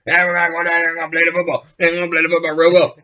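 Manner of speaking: fast, mumbled Cajun coach from Waterboy; Cajun coach impression. Podcaster imitating Ed Orgeron, fast Cajun mumble. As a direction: rattle it off like a Cajun football coach. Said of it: "sounds like the fucking special teams coach from Waterboy".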